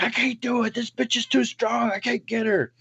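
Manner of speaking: high, strained cry of defeat, imitated; mock a man giving up mid-wrestle; struggling hothead. John gives up struggling with woman.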